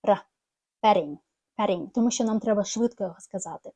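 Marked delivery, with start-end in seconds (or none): 0.8-1.9 "Patting" said as "perring"; flapped t. Shown as a demonstration. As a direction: flap the t in "Patting" like a quick r. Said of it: "воно більше звучить як р".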